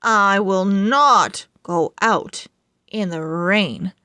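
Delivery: plain reading voice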